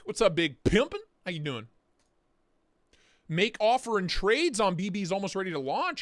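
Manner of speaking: ordinary talk, nothing marked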